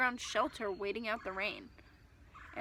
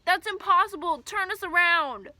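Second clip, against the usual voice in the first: loud, much higher demand; panicked shouting; 8 semitones higher, 8 dB louder than usual. Acted.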